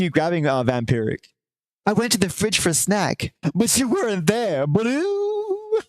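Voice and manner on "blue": meme punchline. Pretend.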